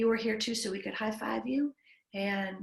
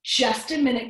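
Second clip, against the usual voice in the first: louder calling out to the room; called out; 2 semitones higher, 9 dB louder than usual. Acted. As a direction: call out to stop everyone. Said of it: "Grandma Naomi called out".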